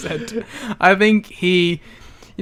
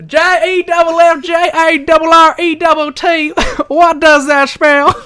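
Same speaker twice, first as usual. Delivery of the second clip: much higher, louder, hammed-up wrestler spelling chant; Jeff Jarrett impression; 11 semitones higher, 5 dB louder than usual. Mocking Russo's impression of the wrestler, loud and high. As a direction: spell it out like a cocky wrestler on the mic. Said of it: "an impression of Jeff Jarrett".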